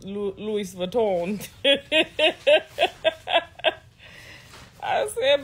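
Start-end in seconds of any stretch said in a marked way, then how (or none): 1.5-3.8 long high-pitched cackle, loud bursts; real laughing fit. Real, not acted. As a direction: crack up hard at your own joke. Cracking up at own joke.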